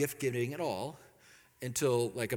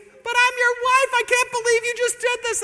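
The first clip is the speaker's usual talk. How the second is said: shocked wife yelling; outraged wife; 12 dB louder than usual. Retold or imitated. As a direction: hammer home "wife" in disbelief. Wife outraged at syrup gift.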